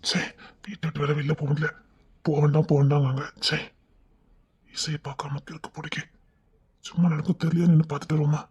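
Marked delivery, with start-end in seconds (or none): 4.7-6.0 unvoiced lovesick whisper to himself; whispered private confession. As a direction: breathe it out, lost in longing. Lovesick man muttering alone.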